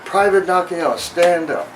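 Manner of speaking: barked, high and loud command; drill instructor shout. Retold or imitated. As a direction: yell it like a drill sergeant calling out a recruit. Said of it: "and he goes".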